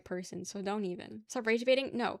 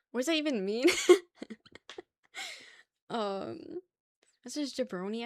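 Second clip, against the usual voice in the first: usual speaking voice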